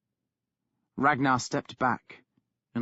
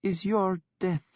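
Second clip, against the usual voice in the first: calm voice; 7 semitones higher than usual. A narrator's reading. Sister calmly pronouncing her brother's doom. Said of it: "in a calm voice".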